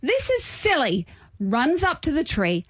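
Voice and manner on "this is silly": high, bossy mother-in-law quote; high and dismissive, taking over. Retold or imitated. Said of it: "she just goes".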